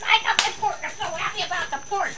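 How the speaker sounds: high, fast happy pup voice; dog's happy voice. Pretend. Voicing the dog thrilled with its pork, high and fast. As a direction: speak as the overjoyed dog. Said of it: "He says".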